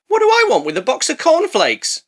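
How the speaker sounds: high-pitched mock bafflement; acted incredulous customer. [name] offered a box by kids, high mock disbelief. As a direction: question the offer as a put-on, baffled buyer.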